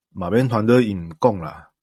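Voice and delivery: ordinary talk, nothing marked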